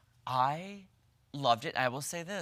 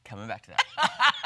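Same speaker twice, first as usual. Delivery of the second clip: laughing; 14 semitones higher, 6 dB louder than usual. Real, not acted. Teasing, laughing at the joke.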